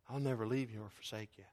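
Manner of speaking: low, soft promise; tender reassurance. Acted. God speaking into a believer's darkness, low tender reassurance. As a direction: reassure softly, as a loving father. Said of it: "he'll say something like this".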